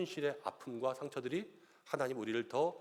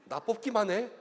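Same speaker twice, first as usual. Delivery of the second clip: building from a teasing warning to a loud, higher threat; mock threat; 8 semitones higher, 5 dB louder than usual. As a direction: play a candidate who says don't vote for me but means vote for me or else. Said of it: "막 이렇게 얘기하시는데".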